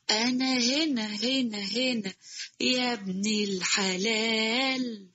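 soft, slow, far-off ghostly call; eerie distant call. Acted. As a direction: beckon from far away, softly and slowly. Ghostly voice calls from nowhere.

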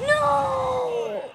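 high, loud cry of dismay; real startled yell; shocked shout. Real, not acted. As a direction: yell it as it goes wrong. Losing the fight suddenly.

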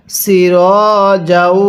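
long held vowels in "সির যাও"; deliberate teaching recitation; model recitation. Shown as a demonstration. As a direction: stretch each syllable for learners. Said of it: "কিভাবে পড়ব".

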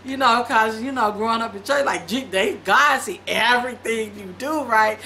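usual speaking voice

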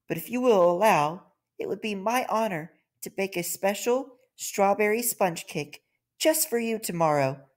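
small and sincere, making amends; humble ant. Acted. The ant apologises to the king, high and humble.

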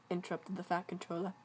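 ordinary delivery, nothing marked

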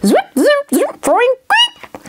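high zips, boing and beep; gadget noises. Acted. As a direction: rattle off cartoon button sounds. Imitating buttons pressed at random.

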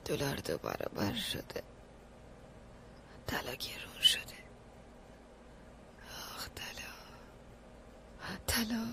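whispered dream mumbling, barely audible; sleep whisper. Acted. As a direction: murmur it half asleep, in a whisper. Mumbling about gold in sleep.

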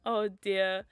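ordinary talk

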